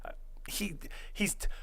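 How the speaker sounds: unvoiced confiding whisper, leaning in; secret whisper. Whispering to the girl about Ray behind his back, hushed. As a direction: whisper it so Ray can't hear.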